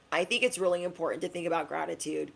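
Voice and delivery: usual speaking voice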